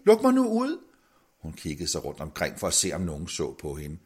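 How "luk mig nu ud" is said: indtrængende bøn. Acted. Indespærret mand beder om at komme ud.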